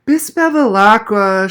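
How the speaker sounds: high, slow, loud tattling wail; bawling girl. A narrator's reading. Abigail calling the teacher to report cheating, bawling. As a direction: bawl out for the teacher. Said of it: "she bawled".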